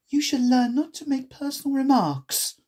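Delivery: put-on high, scolding girl; prim telling-off; severe. A narrator's reading. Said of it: "Alice said with some severity".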